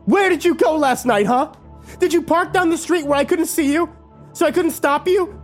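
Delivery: high, accusing questions; furious questioning; angry interrogation. A narrator's reading. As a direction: demand answers. Kimber interrogating in anger.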